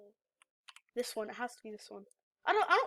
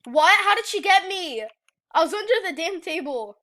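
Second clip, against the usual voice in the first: loud, high disbelieving protest; real outrage, much louder; indignant outburst; 4 semitones higher, 12 dB louder than usual. Real, not acted. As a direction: complain loudly that it isn't fair. Outraged at getting caught.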